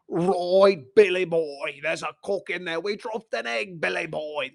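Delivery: a rough Brummie gangster imitation; imitating Arthur Shelby's thick Birmingham growl; Arthur Shelby's Birmingham accent. Fan imitating Arthur Shelby's Birmingham accent in a rough Brummie voice. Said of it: "The English accents, Arthur Shelby".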